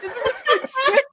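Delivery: very high, slow, laughing imitation of a proud friend; proud Dave impression. Imitating Dave proudly showing off his bag, high and laughing. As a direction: squeak it out like a kid showing off a toy. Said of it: "He goes".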